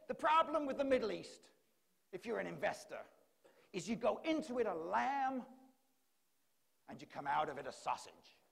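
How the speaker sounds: loud, hammed-up American investor; mock-American, mostly high and loud; attempted American accent. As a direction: imitate a brash American holding forth. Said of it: "Sorry about the accent".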